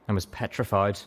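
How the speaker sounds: ordinary delivery, nothing marked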